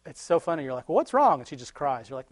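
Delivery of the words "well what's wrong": higher, gentle asking; coaxing parent. Retold or imitated. Parent asking a sick crying child, coaxing. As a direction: coax a crying kid to talk.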